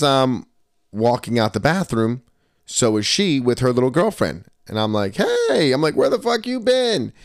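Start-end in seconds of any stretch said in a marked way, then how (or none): 5.2-7.1 high, playful mock indignation; amused greeting. Greeting girlfriend outside the bathroom, amused and high-pitched. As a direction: greet them high and teasing, no real anger. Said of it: "I'm just laughing about it".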